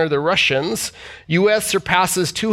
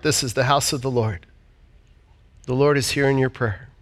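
pastoral prayer; 4 semitones lower than usual. Acted. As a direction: pray over the people gently. Leads congregation in prayer.

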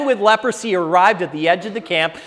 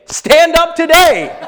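rousing call; 9 semitones higher, 7 dB louder, slower than usual. Acted. Preacher quoting the call to rise.

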